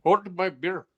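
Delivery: put-on Bangladeshi segment intro; mock Bangladeshi accent. Co-host names the segment on request, mock Bangladeshi accent. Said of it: "your best Blang-, Bangladesh voice".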